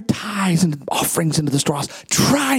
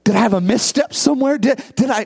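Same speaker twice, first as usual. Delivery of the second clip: usual speaking voice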